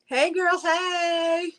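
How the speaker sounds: high, bright greeting squeal. Real, not acted. Seller calls out to her friend Dee joining the stream, high excited squeal.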